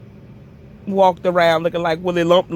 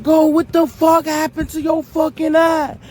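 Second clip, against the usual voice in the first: alarmed question; 9 semitones higher than usual. Retold or imitated. Brother demanding what happened to her eye, high alarmed voice. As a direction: blurt it out in shock.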